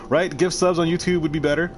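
ordinary talk

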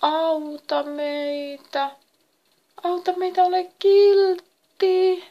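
high, drawn-out wailing plea; pleading cry. A narrator's reading. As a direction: beg for help in long, high tones. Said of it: "ne huusivat jälleen". (korkea, aneleva valitus)

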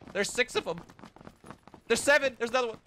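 high excited counting. Real, not acted. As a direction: call it out thrilled.

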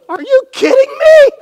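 high, loud squeal of disbelief; incredulous old woman. Retold or imitated. Sarah disbelieving God's promise, in a high loud squeal. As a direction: shriek it like a stunned old lady. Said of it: "And Sarah goes".

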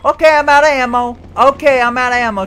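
fast, loud, high urgent rush; panic-rush it; hurried panic. Real, not acted. Gamer out of ammo, fast urgent panic.

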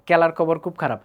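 Mymensingh unaspirated k for every kh; Mymensingh accent. Retold or imitated. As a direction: say it the Mymensingh way, kelar kobor. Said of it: "Mymensingh জোনের সব মানুষরা". (ময়মনসিংহের টানে ক দিয়ে বলা)

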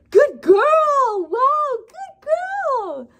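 high, loud squeaky praise; squeaky praising a dog. Real, not acted. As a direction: gush over the puppy, way up high.

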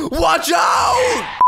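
very high, loud drawn-out yell; shouted warning. Real, not acted. Gamer driving across town, shouted warning.

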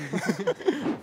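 quick high amused laugh; short laugh. Real, not acted. Host laughing at a contestant's answer.

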